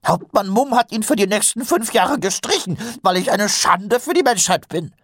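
high-pitched frantic excuse; panicked. A narrator's reading. Corporal begs off duty, calling himself a disgrace, panicked. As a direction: plead your unworthiness in a panic. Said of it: "Nobby geriet in Panik".

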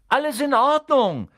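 loud high call upward; boy calling up to friends through a hatch, loud and high. Acted. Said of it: "rief er nach oben".

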